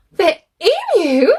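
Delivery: high, loud astonished question; gasped. A narrator's reading. Edward overhears praise of emus, gasping surprise. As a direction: gasp it out in disbelief. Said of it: "gasped Edward".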